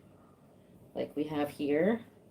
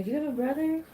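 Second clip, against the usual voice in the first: mock question; 10 semitones higher, faster than usual. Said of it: "They'll be calling you".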